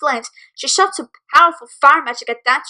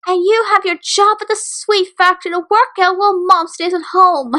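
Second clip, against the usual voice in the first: bored voice; 6 semitones higher, 3 dB louder than usual. A narrator's reading. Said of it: "Caramel said in a bored voice".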